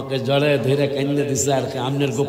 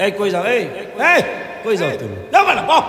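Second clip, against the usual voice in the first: calling out; 6 semitones higher, faster than usual. Real, not acted. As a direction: call him back to sit down. Calling to child leaving gathering. (বাচ্চাকে জোরে ডেকে বসতে বলা)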